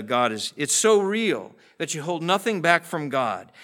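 ordinary delivery

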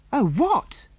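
high, eager burst of curiosity; children's excited question; eager cry. Acted. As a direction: cry out, dying to know. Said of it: "cried all the children".

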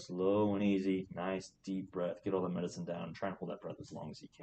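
slow, soft coaching voice; slow soothing coaching. Shown as a demonstration. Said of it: "slow down".

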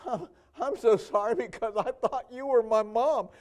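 a touch of weepy daughter; tearful woman. Retold or imitated. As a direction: lightly play her about to cry. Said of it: "And she said".

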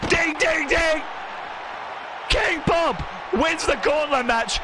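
pumped-up announcer calling the main event; hyped wrestling announcer. Acted. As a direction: shout out every move like a ringside commentator. Wrestling match play-by-play commentary.

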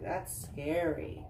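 usual speaking voice

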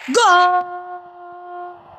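high, loud goal cry; excited goal shout. Real, not acted. Scores in the game.

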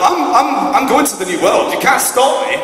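loud, rising proclamation; defiant declaration. Retold or imitated. Son defying his father at the door, loud defiant cry. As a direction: declare it like a hero leaving for the new world. Acting defiant scene exit.

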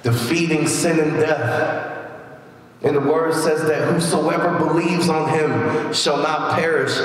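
ordinary talk

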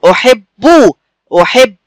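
"أحب" as "u-hib-bu", double b held; doubled b demonstration. Shown as a demonstration. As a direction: show the doubled b in "أحب" with a firm final u. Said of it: "قولوا معايا".

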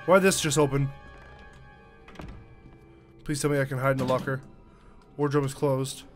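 ordinary talk, nothing marked

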